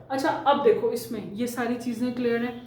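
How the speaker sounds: ordinary delivery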